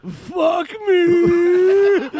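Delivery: high, loud wailing shriek of a laugh; worst laugh imaginable. Pretend. Comedian demonstrates the worst possible laugh, shrieking wail. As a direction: shriek it as a horrible mock laugh. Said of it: "your laugh is going to be this".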